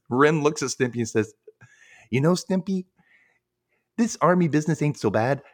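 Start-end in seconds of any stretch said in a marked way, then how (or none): 2.1-5.4 lightly voiced cartoon character, pitched up; half-hearted cartoon impression, higher than usual; a rough try at a cartoon voice; Ren. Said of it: "I can't do his voice very well".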